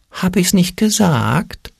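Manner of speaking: high, slow I-told-you-so; thin little voice. A narrator's reading. Little voice gloats after Ole's fall, high and slow. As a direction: needle him slowly in a tiny high voice. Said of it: "flüsterte das Stimmchen".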